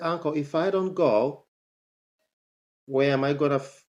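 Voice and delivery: ordinary delivery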